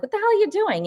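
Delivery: high-pitched mock yell; imitating a startled shout at the aunt; retold yell. Startled at her aunt after the door slams, high mock yell. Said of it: "I'm yelling at her".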